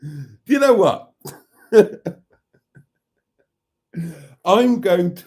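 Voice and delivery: helpless laughter breaking up the words; real burst of laughter mid-sentence; cracking up. Real, not acted. Cracked up by the voice note.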